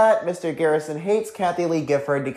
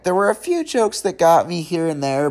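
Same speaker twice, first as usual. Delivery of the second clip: usual speaking voice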